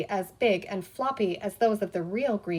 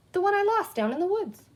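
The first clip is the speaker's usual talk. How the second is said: surprised; 9 semitones higher than usual. A narrator's reading. Peter recognises his truck.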